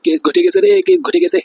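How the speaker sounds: high-pitched panicky cry; alarmed wail. Acted. Officer lamenting that a case has blown up, alarmed wail.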